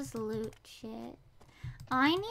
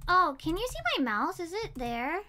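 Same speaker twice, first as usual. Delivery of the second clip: ordinary delivery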